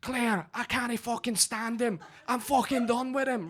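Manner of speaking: high-pitched imitation of a tearful Scottish breakdown; mock crying Scottish woman. Scottish woman crying to her friend about a man, mock distraught. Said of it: "She's crying".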